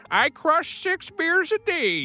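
high, slow hammed-up centenarian voice; very old lady. Old lady telling her secret to long life, high mock old-lady voice. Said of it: "that one lady that lives to".

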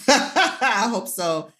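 high, bashful laugh through the words; giggling, slowed way down. Real, not acted. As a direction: laugh it out, touched and slow. Laughing at praise.

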